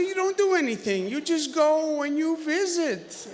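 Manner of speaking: a hint of Clinton drawing out the point; imitating Bill Clinton. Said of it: "He said".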